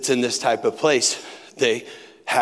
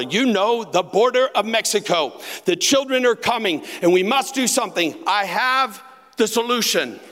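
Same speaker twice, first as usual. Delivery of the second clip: put-on higher, louder urgent old dad; earnest father; 11 semitones higher, 4 dB louder than usual. Son imitating his confused father's urgent scheme, raised voice. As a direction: play dad announcing his grand plan.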